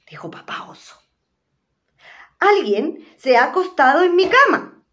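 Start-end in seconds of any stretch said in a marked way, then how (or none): 2.4-4.8 higher, louder mama bear voice; mama bear finding her bed used. Acted. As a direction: complain like a fussy mother bear.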